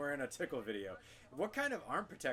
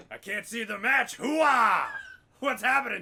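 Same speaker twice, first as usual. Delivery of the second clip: loud bellowing blind colonel; Frank Slade impression; 6 semitones higher, 13 dB louder, slower than usual. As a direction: shout it like the old colonel. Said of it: "you know what he actually would say".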